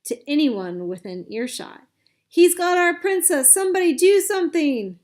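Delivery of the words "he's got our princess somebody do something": very high, loud desperate cries; desperate cry for help. A narrator's reading. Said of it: "His cries".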